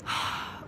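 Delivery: soft, breathy sigh; wistful sigh. Real, not acted. Thinking back on her ankle injury, soft sigh. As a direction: let out a quiet sigh.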